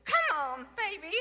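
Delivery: high mock American mom coaxing her kids; mimicking Stacy. Narrator mimics Stacy calling her kids, high coaxing. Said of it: "pasa Stacy y les dice".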